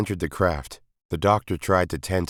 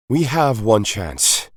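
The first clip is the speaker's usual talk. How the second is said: slow, deliberate, louder; slow and weighty; 6 semitones higher, 7 dB louder, much slower than usual. A narrator's reading. The Doctor announces the only plan, slow and weighty. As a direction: land each word gravely.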